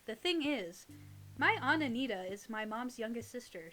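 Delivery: usual speaking voice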